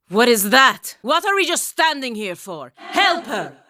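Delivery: jolted by what she sees, push the others to act; alarmed. Acted. Sudden alarm, urging others to help.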